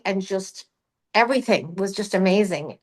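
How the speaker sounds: ordinary talk, nothing marked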